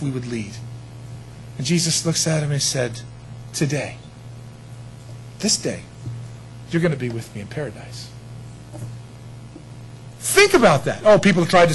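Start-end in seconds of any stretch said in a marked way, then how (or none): 3.5-8.1 soft, low, unhurried promise; quiet promise. Shown as a demonstration. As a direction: say it gently as one flowing line. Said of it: "that's not the structure of what he said".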